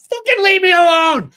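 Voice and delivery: high, loud exasperated shout; mock yell. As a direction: yell it like he's had enough. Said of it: "I'm like".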